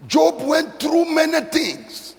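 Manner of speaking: loud, very high climactic sermon cry; preaching at full force. Acted. Preacher praying over the congregation to recover, loud and very high. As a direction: build to a shouting preaching peak.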